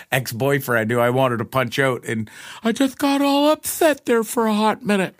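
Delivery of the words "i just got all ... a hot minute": very high, put-on squeaky voice; mock upset. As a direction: poke fun at your own little meltdown. Mocks own past upset.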